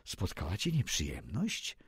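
a low, hushed question; quietly. A narrator's reading. Adelin quietly asks the narrator at the inn, hushed. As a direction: lean in and ask it softly. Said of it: "mówi z cicha".

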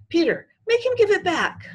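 high, loud, desperate appeal; pleading girl. A narrator's reading. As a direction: wail for help from a classmate. Sheila, near tears, appeals to Peter.

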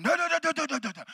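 high, gibberish echo of a preacher; imitated interpreter. Interpreter echoing the preacher's prayer, imitated in gibberish. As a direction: parrot the prayer in nonsense syllables. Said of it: "And they're interpreting".

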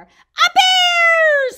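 very high, loud shriek; drawn-out storybook scream; acted scream. A narrator's reading. Eddie comes face to face with bear, screaming. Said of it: "screamed Eddie".

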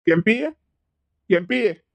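loud summons by name; calling out. Acted. Grandfather summons grandmother to confront her, calling out. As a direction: call to someone out of sight. Grandfather calls for his wife.

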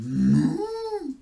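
long, high, loud moo; drawn-out cow's lowing. A narrator's reading. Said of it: "And the cow said".

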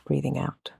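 normal talking voice

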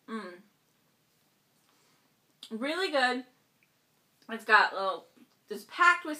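talking while chewing cupcake; muffled, hand over mouth; mouth full, chewing. Real, not acted. Seen 1: Bites cupcake at -1, chews behind hand, talks mid-chew after.

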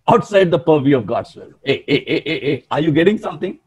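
normal talking voice

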